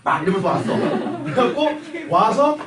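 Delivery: ordinary talk, nothing marked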